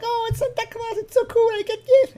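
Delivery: put-on very high, thrilled little child; high-pitched child gushing over Santa; excited kid voice. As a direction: squeal it like a kid meeting Santa. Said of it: "The kid's like".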